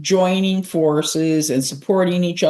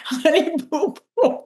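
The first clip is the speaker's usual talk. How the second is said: squeaky, much higher mock-childish voice; silly high voice; 9 semitones higher than usual. Co-host riffing on the name, goofy high squeak. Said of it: "Now we're just getting overly silly".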